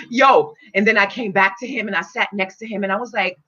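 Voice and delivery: ordinary delivery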